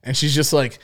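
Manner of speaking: ordinary talk, nothing marked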